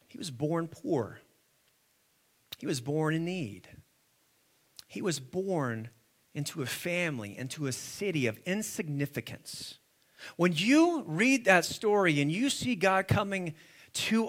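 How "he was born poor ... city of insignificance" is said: low, soft, weighty phrases; hushed sermon storytelling. Real, not acted. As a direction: let each humble detail sink in quietly. Hushed preaching.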